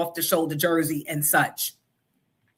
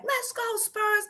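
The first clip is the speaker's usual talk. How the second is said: put-on high, light kid voice for the son; a hint of a young son cheering; son's voice; 14 semitones higher, slower than usual. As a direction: mimic her boy's cheer. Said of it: "He came home and was like".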